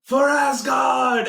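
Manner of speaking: slow, high, drawn-out shout on Asgard; a warrior bellowing as he leaps; Viking battle cry. Retold or imitated. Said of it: "He's like".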